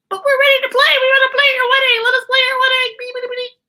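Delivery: very high squeaky little ants; tiny ant band voice. Acted. Ants begging the couple to let them play, very high squeaky voices. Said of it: "The ant band's like".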